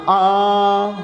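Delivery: آ said as a long open "aa". Shown as a demonstration. Teacher modelling the ending for students, long "aa" in آ. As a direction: hold آ as a long open vowel. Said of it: "آنگ نہیں ہے تو کیا ہو جائے گا".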